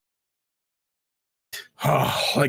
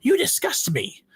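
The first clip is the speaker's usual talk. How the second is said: disgusted sneer; 10 semitones higher than usual. Acted. Disgusted high line.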